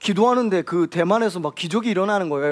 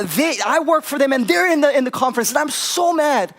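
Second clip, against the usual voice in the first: angry; 8 semitones higher, 4 dB louder than usual. Retold or imitated. Woman complaining about her church bosses, angry. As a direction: spit out the grievance. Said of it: "and she got really angry".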